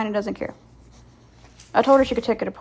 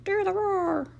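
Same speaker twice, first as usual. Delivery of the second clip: Shrek roar imitation; 11 semitones higher, slower than usual. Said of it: "Like on Shrek".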